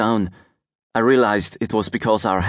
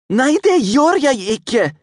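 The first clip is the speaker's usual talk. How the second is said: put-on high, louder childish snap in Norwegian; snapped; 5 dB louder than usual. A narrator's reading. Norwegian boy snapping back at the girl who mocked him, loud. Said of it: "I snapped".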